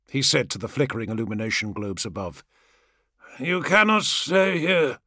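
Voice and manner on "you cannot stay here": slow, higher-pitched command; involuntary warning. A narrator's reading. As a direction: order them away in a raised, slow voice. Words come out against his will.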